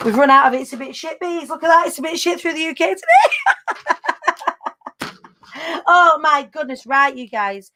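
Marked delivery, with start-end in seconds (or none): 3.1-5.1 high-pitched, helpless cackle; real laughing fit. Real, not acted. Host cracks up at her weather map, high cackling laugh. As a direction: burst into a long, high laugh.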